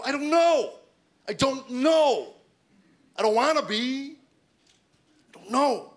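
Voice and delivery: high, loud despairing cry; exasperated wail. Retold or imitated. Husband answering his wife's question, exasperated wail. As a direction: wail it out, dragging the last word. Acted exasperated reply to wife.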